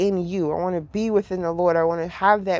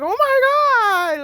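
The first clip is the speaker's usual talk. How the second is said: high, slow, stretched-out oh my God; thrilled shriek of surprise; excited gasp; 6 dB louder, much slower than usual. Retold or imitated. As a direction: squeal it out in disbelief. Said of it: "be like".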